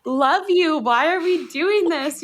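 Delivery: high, exasperated inner voice; inner voice mocking. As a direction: voice the fed-up part of yourself, amused. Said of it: "this meta part of you that's like".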